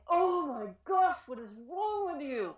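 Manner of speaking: high, exasperated outburst; frustrated teacher losing patience. Shown as a demonstration. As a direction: snap it out like a fed-up teacher. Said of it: "feel a little aggressive and attacking and judgmental".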